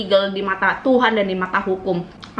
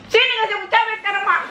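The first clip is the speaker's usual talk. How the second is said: much higher, fast scolding demand; mock angry mother; 12 semitones higher, faster than usual. Mother demanding chili to punish child, fast shrill demand. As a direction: snap it like a fed-up mum.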